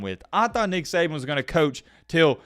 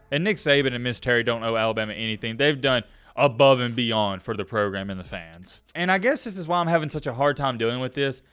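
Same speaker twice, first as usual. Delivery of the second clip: normal talking voice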